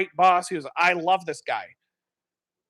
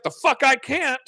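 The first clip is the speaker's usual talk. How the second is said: higher, cocky comeback; defiant retort; 9 semitones higher than usual. Retold or imitated. Boss defying the film crew, cocky retort. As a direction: toss it back with swagger. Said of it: "He's like".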